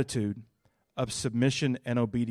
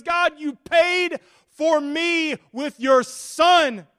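much higher, louder, slowing to the end; impassioned pleading prayer; 10 semitones higher, 7 dB louder than usual. Acted. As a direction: give God everything, crying it out. Impassioned preaching climax.

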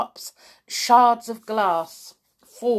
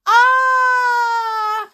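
high, long yell of alarm; sustained open-mouthed scream; loud cry; 7 dB louder than usual. A narrator's reading. Boy sliding too fast, loud cry. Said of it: "he cried".

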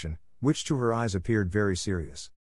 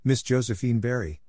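ordinary delivery

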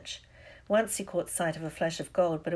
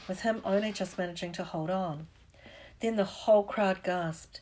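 usual speaking voice

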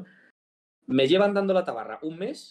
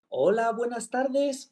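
much higher, slow sing-song greeting; mock call-centre caller; 10 semitones higher, much slower than usual. Retelling a scam call to a friend, hammed-up caller voice. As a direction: stretch the greeting like an over-polite operator.

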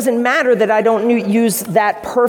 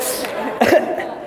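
breathy laugh; 4 semitones higher, 4 dB quieter than usual. Real, not acted.

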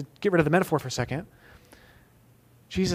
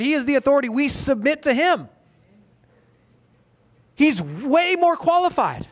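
high, loud, driving sermon delivery; full-force preaching; 7 semitones higher, 4 dB louder than usual. Acted. Pastor to congregation about submission, fervent loud preaching. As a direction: preach it hard and high to the room.

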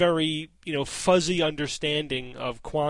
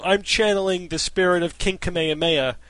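mock announcement, loud; 9 dB louder than usual. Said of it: "that's like saying".

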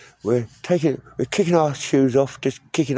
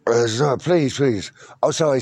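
ordinary delivery

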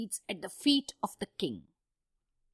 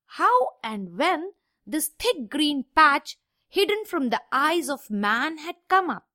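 ordinary delivery

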